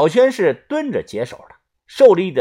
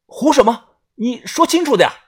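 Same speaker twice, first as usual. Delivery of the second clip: urgent pleading; 7 semitones higher than usual. Acted. Urging dying man to speak.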